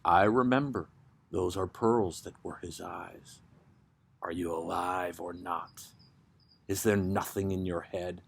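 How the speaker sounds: low, soft, lightly voiced tired man; weary reply. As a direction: answer from far away, drained. The silent partner answers.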